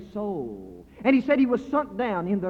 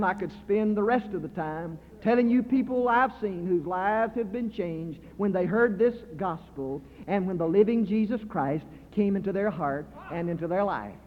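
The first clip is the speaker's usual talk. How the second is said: normal talking voice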